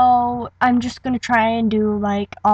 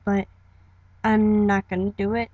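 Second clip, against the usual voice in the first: normal talking voice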